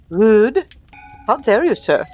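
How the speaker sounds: loud, indignant outburst; affronted child. A narrator's reading. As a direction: exclaim it like an offended child playing door guard. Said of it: "sounded more like an affronted child".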